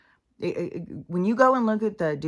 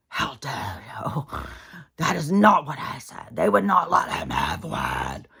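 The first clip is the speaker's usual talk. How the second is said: mock fury; 4 dB louder than usual. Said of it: "she was furious".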